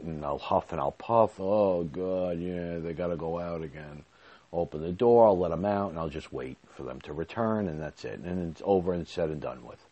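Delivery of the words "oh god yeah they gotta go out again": a hint of weary, put-upon grumbling; lightly voiced, tired sigh at the barking dogs; tired grumble. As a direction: mutter it like a worn-out man at the door. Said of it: "all tired and, you know, drawn out".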